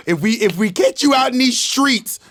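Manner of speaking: playful gangster impression; mock mafia threat. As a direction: threaten like a joke mafia enforcer. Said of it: "Nickelodeon is the fucking mafia".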